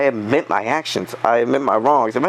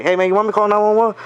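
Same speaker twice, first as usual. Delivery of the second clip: fast, high mock-concerned offer; sarcastic offer; 9 semitones higher, 3 dB louder, faster than usual. Worker needling the bully with fake concern, fast and high. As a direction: pretend to care, needling him. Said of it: "I was like".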